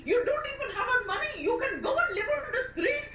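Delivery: high-pitched angry tirade; furious. Acted. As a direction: throw them out with rising outrage. Wife throws partner out in quarrel.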